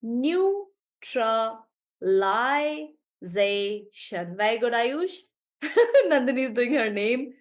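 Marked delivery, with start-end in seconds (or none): 0.0-4.3 "Neutralization" drawn out very slowly; slow syllable read. Shown as a demonstration. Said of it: "I think it's two".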